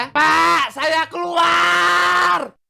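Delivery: very slow, drawn-out shout; long drawn defiant yell; final shout. Shown as a demonstration. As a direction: stretch it out, defiant. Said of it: "Mana suaranya?".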